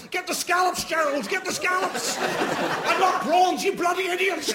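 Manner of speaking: high, loud, shrieking middle-class wife; wife screeching at Gerald by the meal deal; frantic posh shopper. Retold or imitated. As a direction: bark orders at her husband over the scallops.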